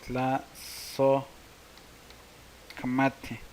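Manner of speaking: very slowly drawn-out Tlazohcamati; slow pronunciation. Shown as a demonstration. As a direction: stretch Tlazohcamati syllable by syllable. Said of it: "Gracias, se dice".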